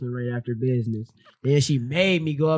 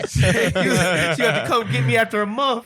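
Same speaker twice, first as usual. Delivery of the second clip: laughing; 8 semitones higher, slower than usual. Real, not acted. Laughing at his own story.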